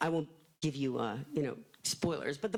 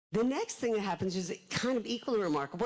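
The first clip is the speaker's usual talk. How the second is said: ordinary talk, nothing marked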